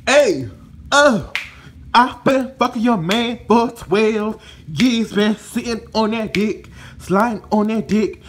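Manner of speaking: a hammed-up brazen mistress, loud and high; mocking Kaisha bragging to the wife, loud and high. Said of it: "she's all like".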